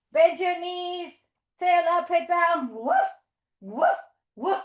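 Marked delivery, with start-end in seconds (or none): 2.7-4.6 short playful woofs; dog bark. Pretend. Teacher being Lola on her trip, short barks.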